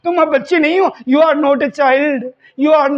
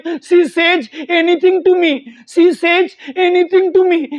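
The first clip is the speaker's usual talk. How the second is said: very slow, repeated for practice; slow practice drill; 2 semitones higher, much slower than usual. Shown as a demonstration. Teacher repeats the wife's line slowly for practice. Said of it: "ऐसे आप बार-बार practice करोगे ना".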